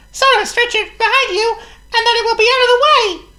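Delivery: very high, loud laughing mouse; a tiny mouse giggling at his idea; squeaky laughing mouse; Squeak the mouse. Acted. Voices Squeak.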